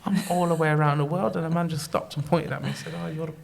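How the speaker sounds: mildly hammed-up disbelief; retold amazement. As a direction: marvel at being spotted so far from home. Retelling his amazement at being recognised abroad.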